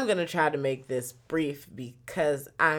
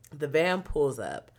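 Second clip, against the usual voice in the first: ordinary talk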